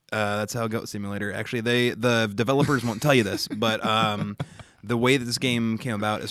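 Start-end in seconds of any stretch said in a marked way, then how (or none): none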